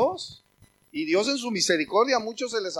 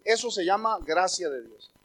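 normal talking voice

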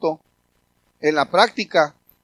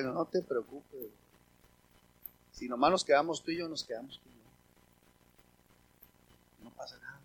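gentle comfort; 6 semitones lower, 9 dB quieter than usual. Retold or imitated. Pastor comforting a weary church helper, very soft. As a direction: hush and soothe a tired friend. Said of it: "lo agarré".

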